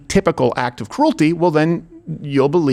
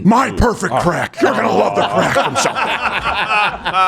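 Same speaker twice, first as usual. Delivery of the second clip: slow, higher put-on voice with laughter; mock dealer; 5 semitones higher, slower than usual. Host playing a sleazy crack dealer, slow and laughing.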